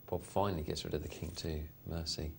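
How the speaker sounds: normal talking voice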